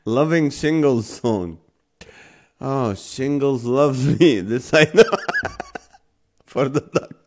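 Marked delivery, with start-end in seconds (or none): none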